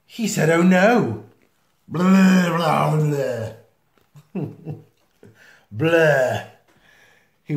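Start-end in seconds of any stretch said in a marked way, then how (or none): 0.5-1.2 loud sudden dismay; alarmed oh no. Acted. Crewman crying out in alarm before being sick. 1.9-4.4 hammed-up retching blur; loud mock vomiting. Reading to a child, loud hammed-up vomiting. 5.7-6.5 hammed-up retching blur; loud mock vomiting. Reading to a child, loud hammed-up vomiting.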